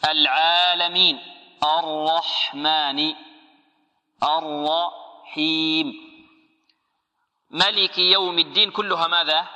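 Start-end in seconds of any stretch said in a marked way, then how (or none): 0.1-6.1 drawn-out recitation of العالمين الرحمن الرحيم; stretched tajweed recitation; slow Quran recitation. Shown as a demonstration. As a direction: chant each word long and measured. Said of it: "teacher recites verses slowly".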